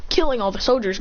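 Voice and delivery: plain reading voice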